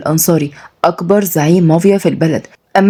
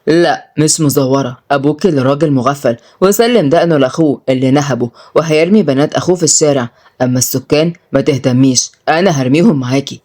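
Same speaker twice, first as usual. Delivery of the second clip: low, unmoved crime boss; cold; 4 semitones lower than usual. Acted. Mafia boss threatens eviction to Malak, cold. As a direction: answer coolly in a lowered voice. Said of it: "رد سالم ببرود".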